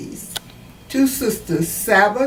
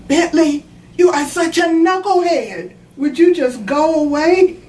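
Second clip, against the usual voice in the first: higher, louder bossy little-sister scold; girl scolding brother; 8 semitones higher, 3 dB louder than usual. Retold or imitated. Grandma quotes the granddaughter scolding her brother Bentley, in a higher, louder bossy voice. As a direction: snap at a pesky brother like a child.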